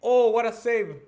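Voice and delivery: excited exclamation. Real, not acted. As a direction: burst out loud and high at the save. Sudden save in live commentary.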